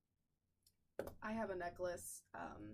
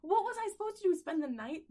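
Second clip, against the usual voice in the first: much higher and louder; indignant disbelief; outraged rhetorical question; incredulous; 11 semitones higher, 12 dB louder than usual. Real, not acted.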